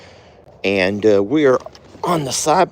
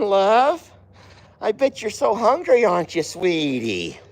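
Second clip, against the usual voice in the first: high sing-song cooing to the animals; 9 semitones higher than usual. Real, not acted. High sweet talk to animals.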